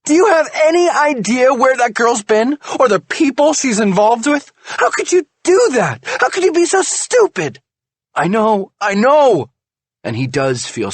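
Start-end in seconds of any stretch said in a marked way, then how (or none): 0.0-7.6 very high, loud furious tirade; furious screaming. A narrator's reading. Wife berates husband for being stupid, furious. 8.1-9.5 high, loud ashamed admission. A narrator's reading. Husband admits stupidity to wife, ashamed.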